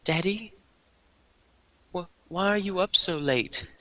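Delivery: put-on high-pitched little girl; small girl's voice. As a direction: speak high, as a small child calling Daddy. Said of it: "you hear a small girl's voice say".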